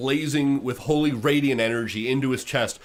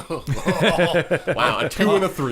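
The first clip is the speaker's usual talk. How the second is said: laughing; 5 semitones higher, 4 dB louder, slower than usual. Real, not acted. Laughing at low dice roll.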